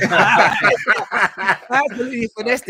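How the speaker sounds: cracking up mid-boast; laughing. Real, not acted. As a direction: chuckle while boasting you finessed it. Bragging about blagging the TV spot.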